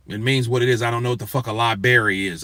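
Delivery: ordinary talk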